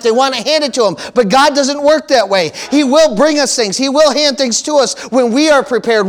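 fiery preacher rebuke; preaching at full force. Acted. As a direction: drive the rebuke home to the church.

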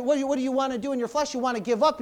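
usual speaking voice

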